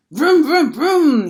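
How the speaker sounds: put-on loud, high engine noises like a toddler on a bike; yelled motorcycle vroom. A narrator's reading. Little brother on his toddle bike, yelling motorcycle noises. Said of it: "he yells".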